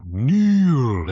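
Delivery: loud, high command; booming command. Retold or imitated. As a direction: boom the word like a villain king. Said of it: "that stuff was on repeat".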